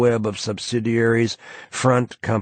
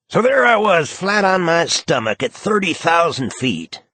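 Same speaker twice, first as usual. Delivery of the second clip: higher, louder camp storyteller voice; A J's voice; 9 semitones higher, 4 dB louder than usual. A narrator's reading. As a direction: spin a tall tale with flamboyant flair. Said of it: "A J".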